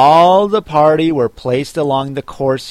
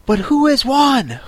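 high, breathless question with audible puffs; panting question; 11 semitones higher than usual. A narrator's reading. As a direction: ask while puffing from a race. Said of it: "panting and asking".

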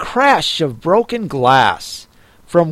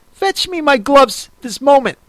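higher fussy Rabbit calling out; 9 semitones higher than usual. A narrator's reading. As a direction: call for the maid, high and impatient. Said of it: "said the voice".

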